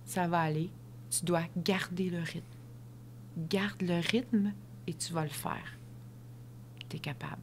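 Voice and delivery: calm her down gently; soft voice. Retold or imitated. Runner reassures her before the race, softly. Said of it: "de sa petite voix douce".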